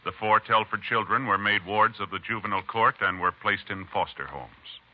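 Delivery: ordinary talk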